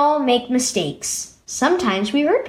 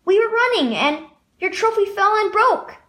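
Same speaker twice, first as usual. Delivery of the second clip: put-on higher, quick childlike pipe; boy blurting out the confession in a high voice; piping up; 8 semitones higher than usual. A narrator's reading. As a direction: pipe up eagerly from the doorway. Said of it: "Danny piped up".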